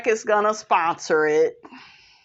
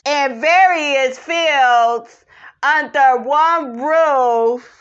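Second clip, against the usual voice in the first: loud, higher-pitched, drawn-out delivery; stretched-out words in a raised, projected voice; put-on confessional voice; 6 semitones higher, 5 dB louder, slower than usual. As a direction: play a reality star explaining slowly and loudly. Voices cast member's confessional.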